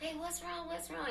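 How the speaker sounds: high, soft imitation of worried friends; friends asking. Friends pressing her at school, soft and high.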